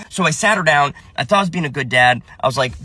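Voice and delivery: normal talking voice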